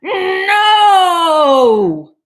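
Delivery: long, high, loud "no"; drawn-out howl sliding down in pitch; mad cat. Acted. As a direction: shout it furiously and stretch it out. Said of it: "that cat's so mad right now".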